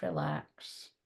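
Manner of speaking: breathy whispered mantra. Acted. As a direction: whisper it softly. Inner mantra in meditation.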